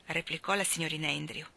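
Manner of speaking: usual speaking voice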